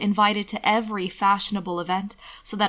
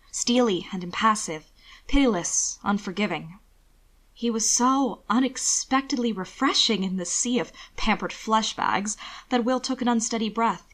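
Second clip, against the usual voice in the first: ordinary delivery, nothing marked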